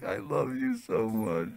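hammed-up tearful gushing; mock sobbing. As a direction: sob it out in comic exaggeration. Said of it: "She started sobbing. She's like".

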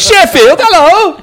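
high, loud, delighted hello to Sheffield; light up and shout a happy hello; excited greeting. Real, not acted. Host greets the guest's home town, thrilled and loud.

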